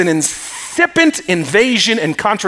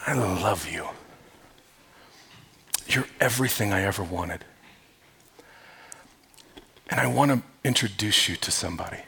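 soft, much lower and quieter, sweetly intimate; tender bride's confession; 5 semitones lower, 8 dB quieter than usual. Acted. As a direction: murmur it to the groom at the altar. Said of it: "you said to him".

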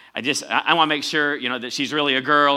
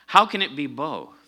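ordinary talk

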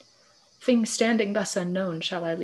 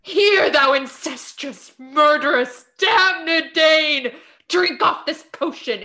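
furious accusation; 6 semitones higher, 4 dB louder, slower than usual. Acted. Hamlet denounces the king.

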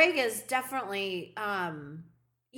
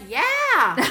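loud, higher-pitched enthusiastic yeah; eager yes; 4 semitones higher, 8 dB louder than usual. Retold or imitated. Friend voicing Maggie's keen answer to the dinner plan, eagerly. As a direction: burst out with an all-in yeah.

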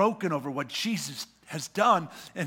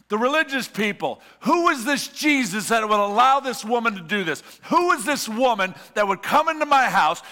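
loud, higher, driving sermon voice; fiery preaching; 4 semitones higher, 6 dB louder than usual. Acted. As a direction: preach it hard to the room. Preaching at full force.

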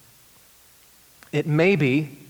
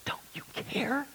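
soft whispered repeat; whispered echo; 8 semitones higher, 16 dB quieter than usual. Retold or imitated. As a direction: hush it, almost to yourself.

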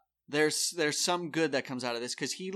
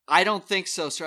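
haughty refusal; 4 semitones higher, 8 dB louder than usual. Retold or imitated. Patricia refuses provisional ballot.

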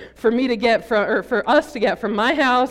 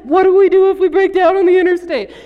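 higher and much louder, frantic worry; panicked sister; 8 semitones higher, 12 dB louder, faster than usual. Retold or imitated. Older sister fearing the van dies on the highway, panicking. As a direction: blurt it as the car jerks. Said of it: "freaking out".

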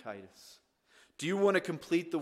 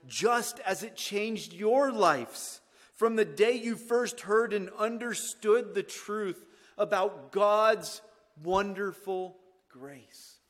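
raised, ringing pulpit reading; preaching scripture; 7 semitones higher, 8 dB louder than usual. Acted. Preacher reading Colossians to the congregation, loud and impassioned. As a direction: proclaim the verse loud and high.

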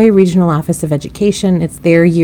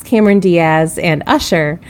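ordinary talk, nothing marked

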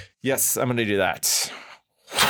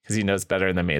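ordinary talk, nothing marked

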